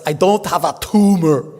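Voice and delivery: a playful Austrian Arnold impression; Schwarzenegger impression. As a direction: quote it as Arnold in a heavy Austrian accent. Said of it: "this is the one where he says".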